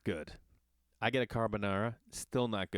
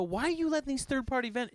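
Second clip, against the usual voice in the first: annoyed; 13 semitones higher than usual. Retold or imitated. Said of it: "then I'm getting annoyed".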